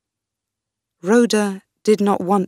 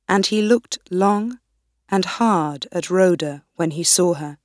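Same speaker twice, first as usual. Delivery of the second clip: ordinary delivery, nothing marked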